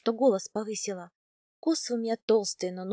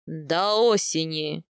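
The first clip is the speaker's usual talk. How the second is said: low, slow, final pronouncement; stern khan; 5 semitones lower, 4 dB louder, much slower than usual. A narrator's reading. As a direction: set the limit as a ruler. Khan sets a deadline. (низкий, веский голос хана)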